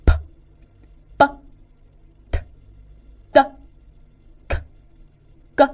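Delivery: p、b、t、d、k、g as short, isolated bursts of sound; plosive demonstration. Shown as a demonstration. Teacher shows learners example plosives, each sound popped separately. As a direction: say each of p, b, t, d, k, g as a bare plosive, pausing between. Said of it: "发出的音我们就叫做爆破音".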